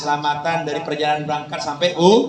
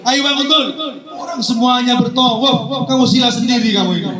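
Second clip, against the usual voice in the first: higher-pitched exasperated telling-off; acted indignant reproach; scolding kiai; 6 semitones higher than usual. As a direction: chide a pupil sitting in the wrong place. Kiai scolds Abdul for sitting.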